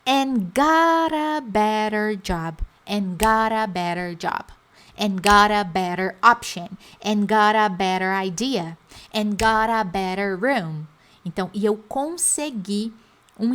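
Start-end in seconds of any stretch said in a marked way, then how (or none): 0.1-10.9 got a said GAR-a in every phrase; flapped T drill. Shown as a demonstration. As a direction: got a better, both Ts flapped like quick Rs. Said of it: "teacher drills phrases with flap".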